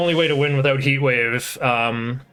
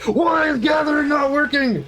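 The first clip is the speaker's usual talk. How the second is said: high, exasperated retold shout; half-yell the complaint in frustration; frustrated. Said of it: "I used to be so frustrated".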